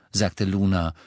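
ordinary delivery, nothing marked

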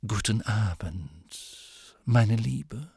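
slow, drawn-out boozy greeting; tipsy professor. Acted. Trelawney greeting Luna after cooking sherry, tipsy drawl. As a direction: stretch it out as if tipsy.

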